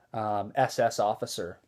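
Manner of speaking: normal talking voice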